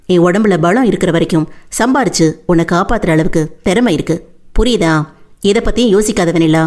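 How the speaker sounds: steady, somewhat low-pitched reassurance from a devoted man; Veerapandi, reassuring. Acted.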